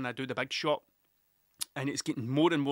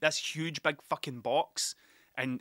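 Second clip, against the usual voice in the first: usual speaking voice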